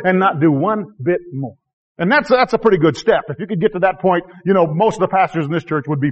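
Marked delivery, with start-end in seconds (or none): none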